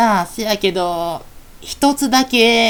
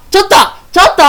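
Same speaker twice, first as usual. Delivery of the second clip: loud calling out; 10 semitones higher, 7 dB louder, much faster than usual. Acted. Fairy calling out loudly to her friend, loud calling. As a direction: call out loud and high. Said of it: "大きな声出しな、".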